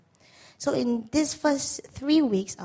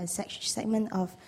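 ordinary talk, nothing marked

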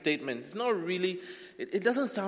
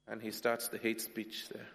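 usual speaking voice

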